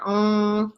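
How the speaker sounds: "ong" drawn out through the nose; stretched nasal "ong"; nasally ong. Shown as a demonstration. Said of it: "you get that nasally".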